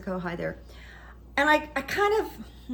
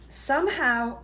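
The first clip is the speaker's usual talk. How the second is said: normal talking voice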